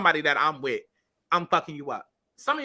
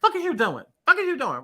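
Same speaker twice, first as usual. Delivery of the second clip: acted quote; 7 semitones higher than usual. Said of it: "more like a".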